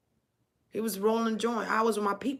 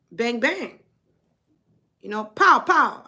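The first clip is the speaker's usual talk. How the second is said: gunshot noises; 3 semitones higher, 8 dB louder than usual. Retold or imitated. Imitating gunshots in story.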